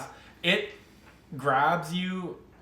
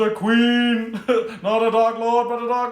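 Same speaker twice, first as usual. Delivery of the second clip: creepy Galadriel voice; 10 semitones higher, 9 dB louder than usual. Retold or imitated. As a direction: proclaim it like a possessed elf queen. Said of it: "the creepy Galadriel voice".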